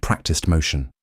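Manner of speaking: usual speaking voice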